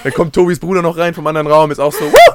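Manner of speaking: laughing into a clipping mic; loud, overdriven and crackling; fuzz distortion. Real, not acted. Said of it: "du übersteuerst gerade alles". (übersteuertes Mikro, lachend)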